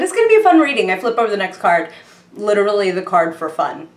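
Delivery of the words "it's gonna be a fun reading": high, loud excited self-quote; gleefully quoting yourself, pitched way up. Retold or imitated. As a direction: repeat your own delighted exclamation. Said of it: "I'm just excited".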